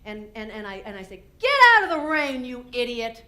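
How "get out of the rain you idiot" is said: loud, high snap at the dog; angry yell. Retold or imitated. Yelling at Ernie to get out of the rain. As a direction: bark it out in frustration.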